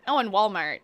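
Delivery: normal talking voice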